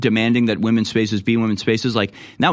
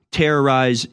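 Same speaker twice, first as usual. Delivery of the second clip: usual speaking voice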